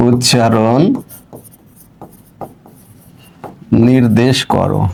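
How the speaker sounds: words dropped one by one with long pauses, as if writing on the board; slow dictation. Acted. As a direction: dictate slowly while writing it out. Dictating question while writing. (ধীরে ধীরে শ্রুতলিপি দেওয়া)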